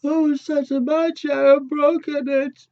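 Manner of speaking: very high, squeaky little bear voice; tiny cub upset about his chair; baby bear squeaking to his parents. A narrator's reading. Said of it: "baby bear says".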